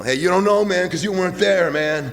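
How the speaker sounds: loud, high, swaggering war-veteran act; fake tough vet. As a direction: bark it like a phony hardened vet, half laughing. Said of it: "I created this fiction of being a wounded Vietnam veteran".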